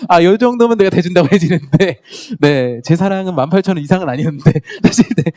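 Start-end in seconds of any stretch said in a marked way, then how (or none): 0.1-2.0 laughing self-quote. Retold or imitated. Retelling his cheap ticket offer.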